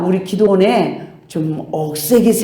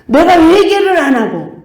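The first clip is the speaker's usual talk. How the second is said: normal talking voice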